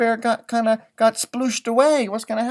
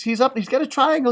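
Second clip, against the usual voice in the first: plain reading voice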